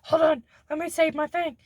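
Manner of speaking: high, put-on eager burst; excited interrupting. Interrupting people with own thing, acted excitement. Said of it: "I get really excited about a thing".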